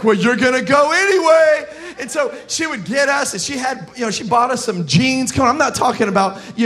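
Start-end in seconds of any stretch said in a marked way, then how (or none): 0.0-1.7 louder, higher, imitated grandma overruling the kids; mock insistence. Grandma overruling reluctant grandkids about farming, mock insistence. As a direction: push it through as a cheerful order.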